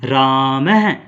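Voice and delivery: राम है, the visarga voiced as a clear breathy है at the end; slow careful रामः with a breathy है after राम; visarga demo. Shown as a demonstration. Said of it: "अब इसका उच्चारण देखो क्या होगा". (विसर्ग का स्पष्ट उच्चारण, राम के बाद साफ़ है)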